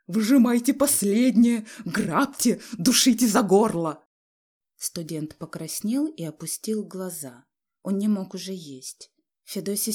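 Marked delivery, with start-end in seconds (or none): 0.1-4.0 very loud hysterical accusations; shrill shouting. A narrator's reading. Father running about the room accusing family, shrill yelling. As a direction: scream as if being robbed. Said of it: "кричал он визгливым голосом".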